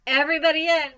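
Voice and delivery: loud, high summons; calling out. A narrator's reading. Mister Arable to everyone boarding the truck, calling out. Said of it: "called Mister Arable".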